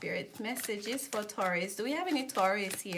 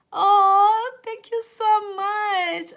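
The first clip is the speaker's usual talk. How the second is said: much higher, louder and slow, overjoyed; excited thanks; 10 semitones higher, 12 dB louder, much slower than usual. Real, not acted. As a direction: gush a thrilled thank-you. Thanking a viewer's donation.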